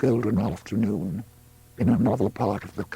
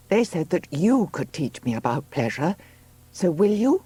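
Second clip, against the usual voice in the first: higher-pitched woman pressing for an answer; Juno, insistent; 8 semitones higher than usual. Acted. Woman in labour to her helper, insistent request. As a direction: press the question in a high voice.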